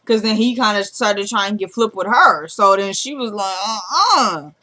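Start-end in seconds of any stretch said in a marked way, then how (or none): 3.7-4.5 drawn-out swooping uh-uh; sassy rebuff. Retold or imitated. She rebuffs him for getting flip, sassy. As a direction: shut him down with a mock indignant refusal.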